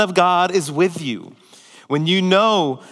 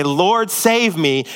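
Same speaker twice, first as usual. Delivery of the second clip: slow deliberate plea; much slower than usual. Shown as a demonstration. Slow emphasized prayer quote.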